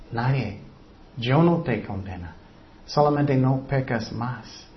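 low, soft, unhurried pardon; tender reassurance. Acted. Jesus to the condemned woman, soft reassurance. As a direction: release them quietly and kindly.

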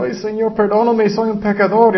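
a light touch of a repentant tax collector; humble sinner. Acted. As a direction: beg forgiveness with a bowed head. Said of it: "él dijo".

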